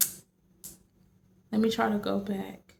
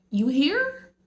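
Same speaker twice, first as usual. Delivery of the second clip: higher-pitched, rising disbelief; shocked; 6 semitones higher than usual. Retold or imitated. Boss startled that the employee showed up, shocked. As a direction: imitate a boss caught off guard. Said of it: "he was shocked".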